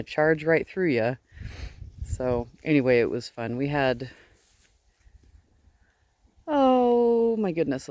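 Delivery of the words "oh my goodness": ordinary talk, nothing marked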